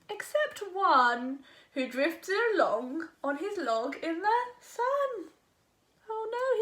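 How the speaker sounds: put-on lively sing-song read-aloud for kids; animated picture book narration; storybook reading. A narrator's reading. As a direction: read it like bedtime story time.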